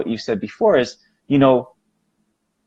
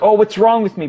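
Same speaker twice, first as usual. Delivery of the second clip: reactive worry; 9 semitones higher than usual. Shown as a demonstration. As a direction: fret at yourself, alarmed. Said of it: "not be reactive".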